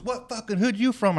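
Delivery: high, loud mock yell; hammed-up confrontational shout; retold street challenge. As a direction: imitate the intruder calling someone out.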